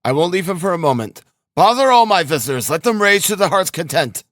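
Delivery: high, vehement insistence; crying out. A narrator's reading. Razumihin refusing to leave his sick friend, vehement cry. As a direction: insist hotly, pitched well up. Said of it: "cried Razumihin".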